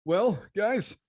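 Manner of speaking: strained from spicy heat; burning throat. Real, not acted. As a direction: choke out a greeting. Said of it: "I can't talk".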